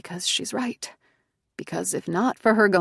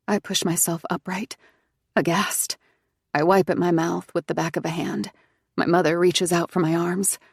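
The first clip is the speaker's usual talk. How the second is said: ordinary delivery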